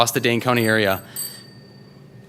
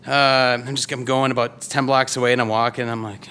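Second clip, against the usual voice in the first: ordinary talk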